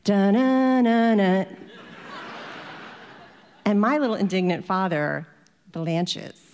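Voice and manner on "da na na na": sung-out mock ringtone, drawn out; imitated phone ring. Storyteller mimics the dealership phone ringing, imitated ringtone. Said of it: "the phone rings".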